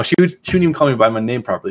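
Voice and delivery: ordinary talk, nothing marked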